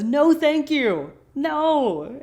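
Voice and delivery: high, loud, stretched-out no; hammed-up high-pitched refusal; amused refusal. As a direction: sing out the refusal, slow and high. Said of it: "he laughs and says".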